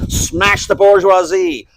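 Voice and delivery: loud, high, drawn-out chant; mock activist slogan. Moral absolutist reciting slogans, loud mock chant. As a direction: proclaim it slowly like a dogmatic militant.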